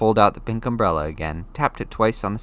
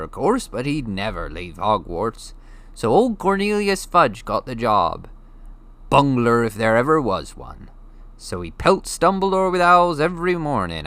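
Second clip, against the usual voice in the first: lightly voiced gruff giant, a bit louder; a giant speaking casually to a boy; Hagrid, big friendly giant; 4 semitones higher, 4 dB louder than usual. A narrator's reading. Hagrid explaining to Harry, casual giant voice.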